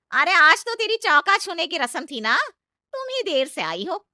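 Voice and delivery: loud, scolding reproach; aunt scolding the new bride loudly. Acted. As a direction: scold her loudly for being late.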